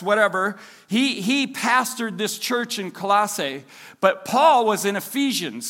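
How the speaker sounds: ordinary talk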